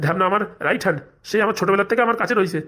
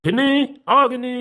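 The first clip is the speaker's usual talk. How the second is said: calling out loudly; 5 semitones higher than usual. Acted. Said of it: "এরকম ষাঁড়ের মতন চেঁচাচ্ছ কেন".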